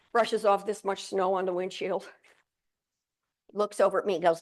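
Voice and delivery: normal talking voice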